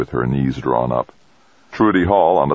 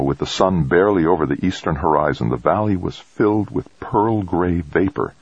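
plain reading voice

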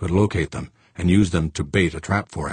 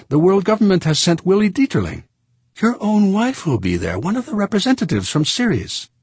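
high, louder French voice, amazed; surprised Frenchman; 11 semitones higher, 4 dB louder than usual. Acted. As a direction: tell Fuchs about the conference in surprise. Saint Clair informing surprised Fuchs.

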